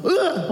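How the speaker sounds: high, sudden startling grunt; mock scare noise. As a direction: make a noise to spook a rat. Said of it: "I'm gonna scare it away".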